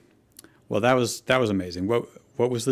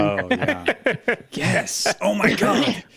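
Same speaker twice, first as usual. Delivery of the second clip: much higher, delighted, loud squeal; excited squeal; 11 semitones higher, 4 dB louder, slower than usual. Real, not acted. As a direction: gush over the crossover idea in a high rush. Delighted at Paw Patrol crossover.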